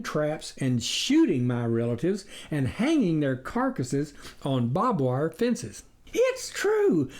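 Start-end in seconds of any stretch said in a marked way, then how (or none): 6.2-7.1 high, eager "It's true"; exclaiming. A narrator's reading. The farmer's wife chiming in to agree, eager. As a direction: burst in to agree. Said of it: "the farmer's wife exclaimed".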